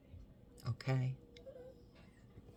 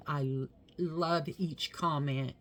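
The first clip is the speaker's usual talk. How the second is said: ordinary talk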